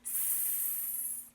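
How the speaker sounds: long, soft sustained hiss; snake hiss. Shown as a demonstration. Teacher showing children the snake sound, long soft hissing. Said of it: "the snake says".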